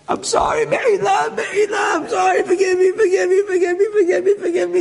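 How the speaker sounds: high, wailing retold plea; acted tearful begging in a high voice; crying, begging. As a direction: beg over and over for forgiveness. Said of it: "He's crying, he's begging".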